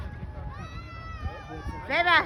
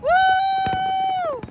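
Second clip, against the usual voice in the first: long, high whoop; cheering woo; 13 semitones higher than usual. Real, not acted. Cheering the Canada Day fireworks, high whoop. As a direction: give a high, drawn-out woo.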